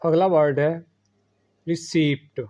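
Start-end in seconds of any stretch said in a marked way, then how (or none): 1.7-2.4 रिसीप्ट with the p sounded, ri-seept; wrong pronunciation. Shown as a demonstration. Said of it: "जो कि गलत है".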